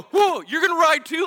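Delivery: high, loud startled outburst; retold shock at an unexpected passenger. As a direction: blurt it out high in alarmed surprise. Startled rider in camel story.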